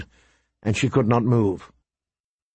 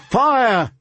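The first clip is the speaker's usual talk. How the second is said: much higher cry of fire; shouted alarm; 10 semitones higher than usual. Acted. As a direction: call out the alarm.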